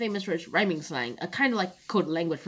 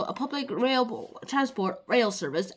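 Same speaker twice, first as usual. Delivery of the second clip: ordinary talk, nothing marked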